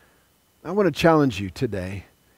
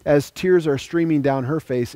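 ordinary talk

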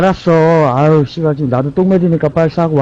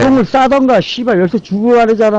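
짜증 섞인 고함; 6 semitones higher, faster than usual. Real, not acted. 열쇠 달라며 다시 욕설.

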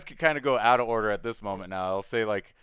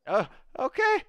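very high-pitched, submissive little goblin; captive goblin; 4 dB louder than usual. Acted. Captured goblin offers help to the party, high squeaky voice.

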